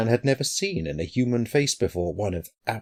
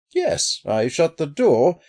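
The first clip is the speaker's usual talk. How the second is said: high, drawn-out, doubtful answer; hesitant, pitched-up reassurance; 8 semitones higher, slower than usual. A narrator's reading. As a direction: answer slowly, unsure of it. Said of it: "said Hobey doubtfully".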